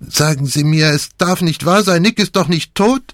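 very high, panicked insistence; desperate plea. A narrator's reading. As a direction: beg to hear she is alive. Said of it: "wiederholte er sie".

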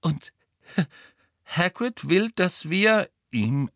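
high start with a nervous laugh, slowing down. A narrator's reading. Harry recounting Hagrid's request to friends, nervously laughing. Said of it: "Ron lachte nervös auf".

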